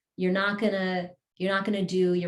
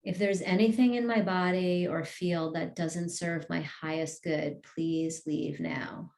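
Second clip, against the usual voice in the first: guided meditation. Acted. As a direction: lead the group gently, word by word. Affirmations continue.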